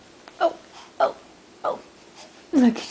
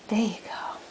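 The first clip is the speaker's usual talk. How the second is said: whispering; 5 semitones lower, 11 dB quieter than usual. Real, not acted. Whispering to baby.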